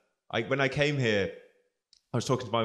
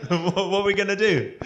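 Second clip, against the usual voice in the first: cheery local; 7 semitones higher, 6 dB louder than usual. Retold or imitated. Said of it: "And they go".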